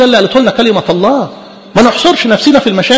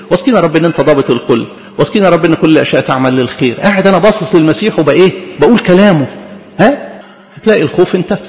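usual speaking voice

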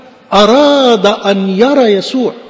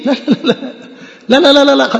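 higher, laughing barrage of no's; 7 semitones higher than usual. Real, not acted. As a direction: laugh at the idea, no no no. Laughing dismissal of self-punishment.

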